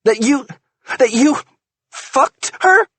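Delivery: high, loud, halting accusation; furious accusation. A narrator's reading. Wife accuses husband of sleeping with her friend, outraged. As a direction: spit out the accusation in disbelief.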